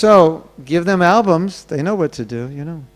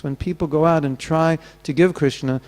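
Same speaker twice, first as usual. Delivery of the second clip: ordinary talk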